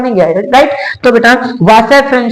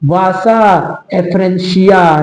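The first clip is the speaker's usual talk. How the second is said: slow drilled term; much slower than usual. Shown as a demonstration. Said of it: "इसी को हम लोग कहते हैं".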